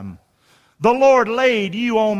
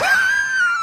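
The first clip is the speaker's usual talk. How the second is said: high-pitched laugh. Real, not acted. Laugh at own joke.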